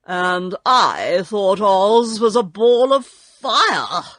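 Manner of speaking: slow, loud lion voice; exclaiming Lion. A narrator's reading. Lion telling what he took Oz for, loud exclamation. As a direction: boom it out slowly as a big beast. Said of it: "exclaimed the Lion".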